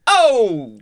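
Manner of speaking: high, drawn-out "ohh!"; retold yelp of alarm; startled "oh!". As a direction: cry out at seeing someone fall. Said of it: "I make the noise".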